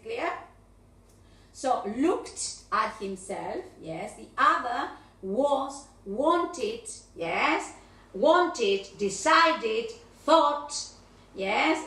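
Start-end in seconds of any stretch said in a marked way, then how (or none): 1.5-3.6 "looked" with a short final t, said slowly; model looked. Shown as a demonstration. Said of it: "cuando yo hago las repeticiones". 8.1-10.7 "Wanted", "decided" and "thought" said loud and one by one; drilled verbs. Shown as a demonstration. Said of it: "repeticiones de los verbos".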